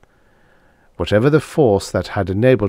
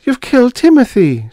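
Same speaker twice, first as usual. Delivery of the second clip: very high, much louder anguished cry; 7 dB louder than usual. Acted. Dana crying out on her knees over the dead monster. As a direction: wail it through tears. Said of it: "she cried".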